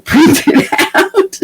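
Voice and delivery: slow words breaking into laughter; real laughter as you speak; laughing. Real, not acted. Laughing over the pile of notes.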